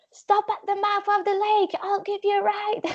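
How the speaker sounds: high, called-out instruction; screaming offer. Retold or imitated. The jet boat guy shouting a ride offer to the paddler, high. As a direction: yell the offer over the engine. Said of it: "he's just screaming this".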